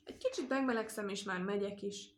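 ordinary delivery

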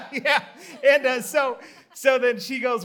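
ordinary talk

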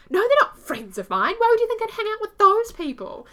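high, loud mocking man voice; mock his indignant protest, hammed-up. Mocking the man who denies knowing the punks, hammed-up. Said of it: "And he said".

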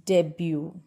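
"debut" as DEB-yoo, no final T; correct silent T. Shown as a demonstration. Teacher modelling for learners, debut as DEB-yoo. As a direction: say "debut" ending in "byoo". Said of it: "This is pronounced as".

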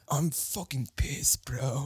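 slow, put-on Danish surfer talk; mock European surfer accent. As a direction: impersonate a European-tinged surfer dude. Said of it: "That was good".

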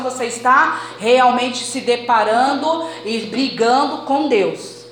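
forceful exhortation in driven phrases; emphatic preaching. Real, not acted. Preacher urging congregation to stop resisting God, emphatic preaching. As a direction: press each point home to the congregation.

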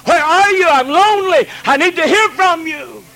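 high, loud pleading call; God calling out loud for his missing children; lonely God crying out. Acted. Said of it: "his lonely voice cries out and says".

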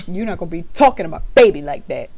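mock scolding. Defending her baby, laughing.

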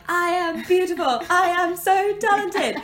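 high, loud, laughing over-the-top boast; silly mirror affirmation. Pretend. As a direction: ham up the self-praise, slowly. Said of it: "feel silly standing in front of the mirror".

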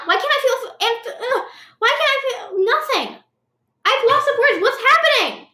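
high panicked shriek. Pretend. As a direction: screech it out in sudden alarm. Panic at volcano news on phone.

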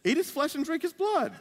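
lilting, over-agreeable parody of church folk; blithely churchy. Acted. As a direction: coo it with mock pious contentment. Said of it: "we're so used to church".

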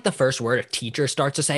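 ordinary talk, nothing marked

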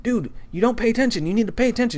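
high-pitched exasperated scolding; frustrated. Retold or imitated. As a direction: scold him without raising volume. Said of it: "I'm just so frustrated".

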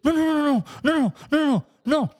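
hammed-up panicky tic; nervous tic. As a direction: imitate someone stuck in a nervous loop. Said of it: "está así súper nervioso con un tic".

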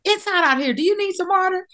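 high, bright caring offer of water; sweet offer of water. Retold or imitated. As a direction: sing it up high like a kind helper. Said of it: "I'm like".

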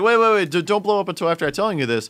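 high, loud, fast urgent hush-up; urgent pleading. Retold or imitated. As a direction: rush to stop her blowing up. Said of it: "It's like".

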